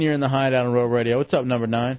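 quick host call intro; radio host intro. Acted. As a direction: rattle off the station intro.

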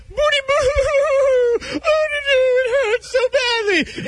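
high, wailing, hammed-up fake sobbing; mock crying. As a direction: whimper it like a big baby, overdone. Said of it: "You know, you just".

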